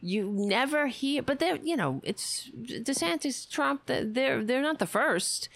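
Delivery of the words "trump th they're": normal talking voice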